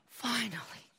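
soft, breathed-out aside; hushed relief. Acted. Imagining Jesus thinking at being recognized, hushed. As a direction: let it out softly to yourself.

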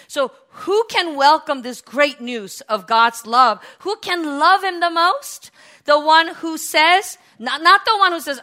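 loud, slow, high-pitched preaching; full-force preaching. Real, not acted. Preacher asking who can love him the most, loud and impassioned. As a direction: belt out the question to the congregation.

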